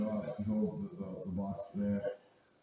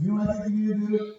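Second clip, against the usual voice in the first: bossy scolding; 10 semitones higher, 7 dB louder, faster than usual. Retold or imitated. Boss voiced, scolding.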